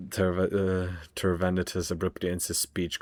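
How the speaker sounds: usual speaking voice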